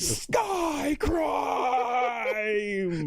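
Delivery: high shouted catchphrase; mock shout. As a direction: yell it like a cheesy promo. Quoting the show, shouting.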